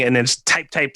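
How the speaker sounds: normal talking voice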